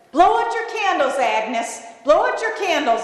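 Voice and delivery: high, insistent call; awkwardly urgent holler; urging. Retold or imitated. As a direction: press them to hurry up. Uneasy owner urging over the sobbing.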